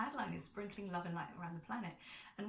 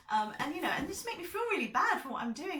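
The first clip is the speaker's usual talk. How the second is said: higher and louder, hurt and worked up; upset outburst; 10 semitones higher, 9 dB louder than usual. Real, not acted. Telling her viewers about the abuse, upset and rising. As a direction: voice rising with real distress.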